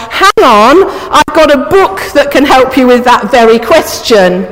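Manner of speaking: usual speaking voice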